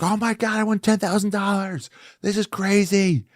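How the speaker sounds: imitating a jackpot winner, very high-pitched; ecstatic winner. Fake ticket winner's joy, retold.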